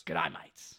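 low mock Aussie echo; mimicked Australian accent. Sidekick parroting the host's impression, mimicked Australian accent. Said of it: "I don't know why I mimicked you after".

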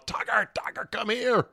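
high whispered coaxing call, mostly breath; coaxing call to the dog. Retold or imitated. Owner calls his runaway dog with treats, high breathy coaxing. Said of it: "shaking the treat bag furiously from afar, going".

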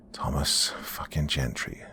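slow, soft, whispery naming; low, drawn-out curse under the breath; hushed recognition. A narrator's reading. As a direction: whisper the name slowly in dread. Narrator recognises the monster's shape.

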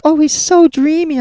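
high, slow lovestruck gush; mock swooning. Women gushing over the handsome fireman, mock swoon. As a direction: sigh it high like a smitten fan.

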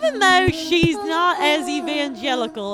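lightly put-on Southern drawl; folksy Southern country gal; Southern accent. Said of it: "closing thoughts in a Southern accent".

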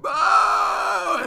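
long, high, loud boo; high shouted jeer, held long; crowd booing. Retold or imitated. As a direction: imitate a screaming crowd with a drawn-out boo. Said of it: "people are screaming".

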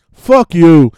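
a sudden, loud "fuck you" after a long pause; loud retort. Real, not acted. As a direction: fire it straight back at the critic. Answering a lecturing critic.

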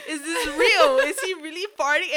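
laughing disbelief. Retold or imitated.

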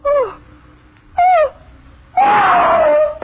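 terrified screams. Acted. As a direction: scream out in horror. Nightmare, horror at a dead body.

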